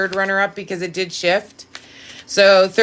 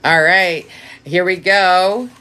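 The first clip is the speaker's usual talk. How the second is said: ordinary talk, nothing marked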